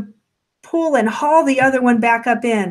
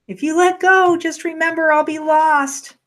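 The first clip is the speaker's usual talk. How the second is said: higher, sustained calling tone; insistent warning; 6 semitones higher than usual. A narrator's reading. Dangling stranger warns the woman holding on, mildly insistent. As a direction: hold the pitch up, mildly pleading.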